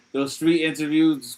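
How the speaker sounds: usual speaking voice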